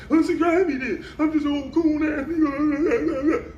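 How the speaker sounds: very high, whiny mocking impression; high-pitched, drawn-out mock wailing; mock upset. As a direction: mimic him all worked up, squeaky and breaking into laughter. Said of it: "you was upset".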